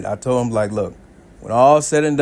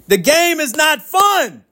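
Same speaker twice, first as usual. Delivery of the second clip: emphatic shout; slower than usual. Real, not acted. Warning divorcees about dating.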